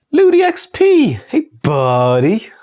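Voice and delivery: high, loud, drawn-out shout of the name; greeting a friend's username in a big, happy, drawn-out call; excited greeting. Real, not acted.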